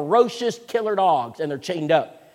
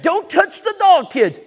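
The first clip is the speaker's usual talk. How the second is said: slow, much higher and louder shout; urgent warning shout; 12 semitones higher, 8 dB louder, slower than usual. Retold or imitated. Father warning his kids off the shocked dog, loud and slow. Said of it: "I said".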